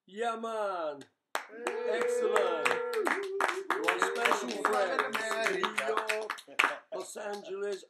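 loud, high hammed-up American accent; mock American accent. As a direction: greet the fans like a broad American. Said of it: "we can't do the accents".

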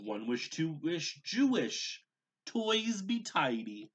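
rising to a loud high magic command; building spell. A narrator's reading. Gabriel showing his mother the spell, building loud incantation. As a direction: build the spell to a showy climax. Boy casting spell again.

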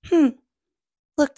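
usual speaking voice

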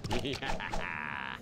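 low, drawn-out villain laugh; deep gloating laughter; gloating cackle. Acted. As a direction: cackle over your fallen foes. Gloating laugh over dead enemies.

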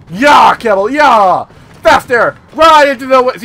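loud whooping yells; cheering shout. Real, not acted. Player cheering his camel into the woods, loud shout. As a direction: urge the camel on at full voice.

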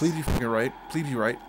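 low, soft anxious pleading. Real, not acted. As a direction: beg under your breath. Player pleading before choice.

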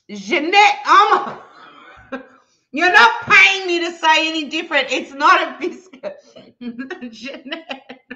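high, loud laughing protest; laughing outrage. Real, not acted. Laughing at Jeanette's super chat, loud protest. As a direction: laugh while refusing.

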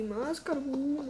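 ordinary delivery

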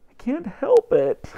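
high, slow, whiny tearful voice; tearful snorting. Acted. Boy can't stop crying before his mother, tearful snorting. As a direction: wail it like a crying child. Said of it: "snorted Alfred".

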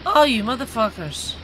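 ordinary delivery

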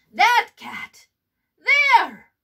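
loud high call; pointing out. A narrator's reading. The perritos showing the Sphinx, loud and high. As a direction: point and shout it. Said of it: "said the perritos".

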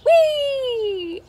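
high, gleeful whee. Acted. Norman leaping onto the snails' pyramid, gleeful. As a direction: fling yourself in with joy.

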